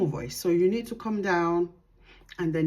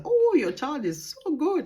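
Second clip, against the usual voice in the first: gushing teacher; 9 semitones higher than usual. Retold or imitated. Teacher praising a child at parents' evening, gushing. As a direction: imitate a teacher cooing praise, much higher. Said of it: "the teacher will say".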